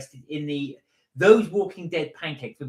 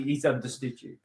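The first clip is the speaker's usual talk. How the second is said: usual speaking voice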